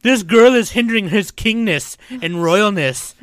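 loud, high, slow mock outrage; Shia LaBeouf, jealous. Playing a jealous underling defending his king's royalness, indignant. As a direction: draw it out with hammed-up indignation. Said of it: "gets all jealous 'cause he's all like".

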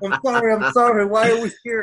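hammed-up sorry to the repairman; apologetic mock plea. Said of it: "I'm trying to cover him".

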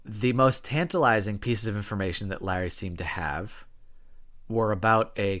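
ordinary delivery

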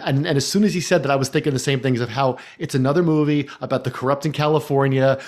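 ordinary talk

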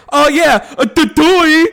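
high, loud goofy "duh"; Britta impression. Mimicking a mocked sitcom friend, loud high goofy voice. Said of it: "And she's like".

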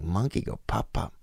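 hushed, puzzled echo; baffled murmur. Retold or imitated. Comic echoing the lady's odd offer, hushed bafflement. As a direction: repeat it softly, as if puzzling it out. Acts own baffled reply to lady.

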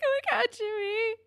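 very high-pitched, touched squeal; moved gushing. Retold or imitated. Fan recalling her reaction to Jimmy's promise, squeaky gushing. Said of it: "it almost made me cry".

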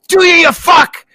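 high, loud mock-furious yell; imitated movie scream. Podcaster quoting a beloved movie line, high imitated scream. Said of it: "I just screamed that in the neighborhood".

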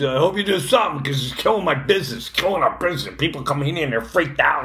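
a hint of a brusque shop owner; fast, fed-up mock grumble; gruff husband complaint.

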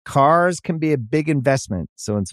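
ad read. Acted.

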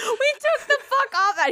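high, squealing through real laughter; laughing hard. Real, not acted. Recalling dad speeding away from angry driver, laughing hard. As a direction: crack up while getting the words out.

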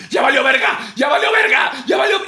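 high, loud panicked yelling; panicked shout. Retold or imitated. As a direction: scream it as the plane falls. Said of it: "yo estaría gritando así". (grito de pánico, agudo y fuerte)

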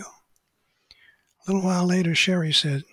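ordinary delivery

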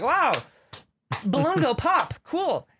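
high, slow, dopey amazement; dim awed classmates. Retold or imitated. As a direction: play it like a simple caveman crowd. Classmates awed by popping balloon.

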